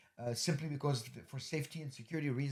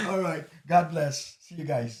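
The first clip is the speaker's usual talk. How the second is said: louder, still laughing as he signs off; laughing goodbye; 5 semitones higher, 11 dB louder than usual. Real, not acted. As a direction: wrap up the show through the laughter. Cheerful sign-off after laughing.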